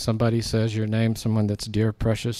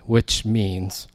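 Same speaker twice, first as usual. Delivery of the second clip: ordinary delivery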